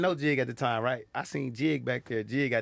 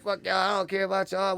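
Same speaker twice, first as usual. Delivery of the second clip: higher-pitched defiant dismissal; mock indignant outburst, pitched up; retold anger; 8 semitones higher than usual. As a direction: act out being mad at the crowd. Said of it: "I got mad and said".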